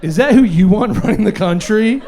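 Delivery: hammed-up campaign smear read; political attack ad voice. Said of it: "Political attack ad voice".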